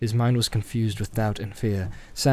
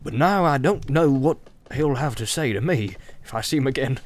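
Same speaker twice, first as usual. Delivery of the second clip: rambling innkeeper, pitched up in bursts; Butterbur, garrulous and fretful; 3 semitones higher than usual. Acted. Butterbur worries what the wizard will do, garrulous and fretful. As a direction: chatter on, fretting about what's coming. Acts Butterbur talking of Gandalf.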